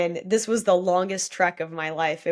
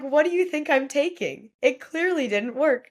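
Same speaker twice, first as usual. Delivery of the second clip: higher-pitched mock indignation; incredulous retort; 8 semitones higher than usual. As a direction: protest as if the test is absurd. Joking retort to drug test.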